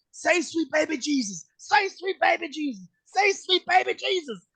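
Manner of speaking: high, slow, sing-song taunt, rising each time; taunting put-on voice. Wrestler taunting the helpless Josh in a high, slow mocking voice. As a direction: lord it over a beaten opponent, slowly.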